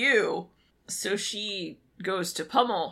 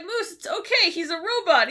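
reassuring friends; 9 semitones higher than usual. Retold or imitated. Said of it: "they're like".

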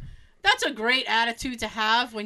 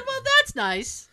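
retold sarcastic reply; 12 semitones higher, 4 dB louder than usual. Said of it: "I said".

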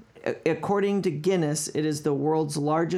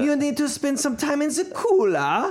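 high hammed-up German accent; mock German accent; 10 semitones higher, 4 dB louder than usual. Podcast bit, comic Nazi-film German accent. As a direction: bark it like a movie German officer. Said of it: "it's a Nazi accent".

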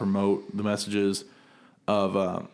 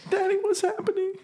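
put-on scared child. Child asking at funeral.

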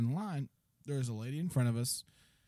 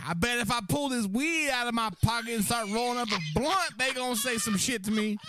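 much higher, louder sing-song boast; rowdy customer boast; 10 semitones higher, 6 dB louder than usual. Retold or imitated. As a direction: brag it half-singing.

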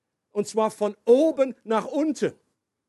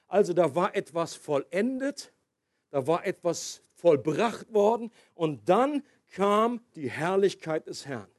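usual speaking voice

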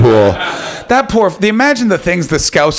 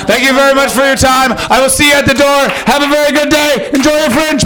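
chirpy sign-off; 10 semitones higher than usual. Pretend. Mock cheery sign-off to crowd.

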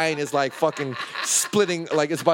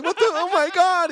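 high pleading oh my God; flustered plea to the old man; mock startled panic. Friend grabbed by old man, high frightened plea. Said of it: "he was like".

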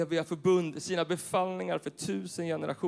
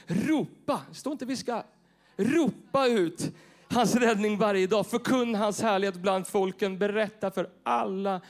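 rousing call; 4 semitones higher, 5 dB louder, slower than usual. Real, not acted. Urges congregation to shout.

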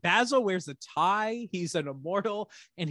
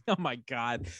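ordinary delivery

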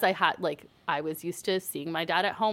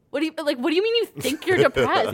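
high, louder disbelieving mom; mock her shocked disbelief; incredulous mom; 9 semitones higher, 6 dB louder than usual. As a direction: mom baffled by daughter's news, high incredulous. Mom's incredulous retort, retold.

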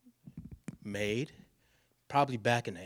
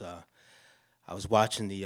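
usual speaking voice

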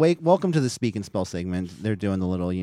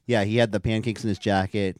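ordinary talk

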